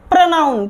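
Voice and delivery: "प्रोनाउन" as pra-NAUN, short first vowel; correct pronunciation. Shown as a demonstration. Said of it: "प्रोनाउन बोलेंगे". ("प्रोनाउन" को प्र-नाउन बोलते हुए)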